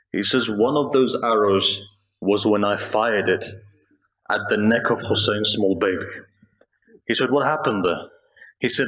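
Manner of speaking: low mournful recitation. Real, not acted. Preacher reciting the Karbala tragedy to mourners, low and mournful. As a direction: draw the mourners in gently, low and sorrowful. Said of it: "If I can recite".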